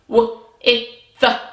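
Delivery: "W, I, th" as separate short phonics sounds; sounded out. Shown as a demonstration. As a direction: break the word into "W, I, th" with gaps. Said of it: "ready to stretch it out".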